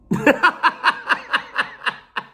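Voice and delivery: high, stuttering cackle; wheezing laugh. Real, not acted. Storyteller cracking up over the date question, wheezing laugh. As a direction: burst into a long helpless laugh.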